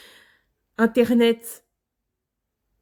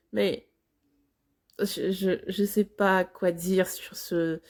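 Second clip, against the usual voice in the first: halting, softer and lower, trailing away; awkward hesitation; 3 semitones lower, 4 dB quieter than usual. Real, not acted. Reactor at a loss over the clip, awkward and hesitant. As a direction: fumble for words, then give up quietly.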